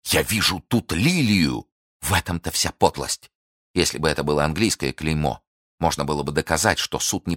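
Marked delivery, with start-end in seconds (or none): 0.1-1.7 slow, astonished cry; drawn-out, mild outcry of shock; shocked exclamation. A narrator's reading. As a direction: exclaim at a startling discovery. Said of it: "воскликнул Фельтон".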